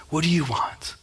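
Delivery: soft, whispered question to the Lord; hushed prayer. Acted. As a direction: drop to a reverent whisper. Acted hushed prayer to God.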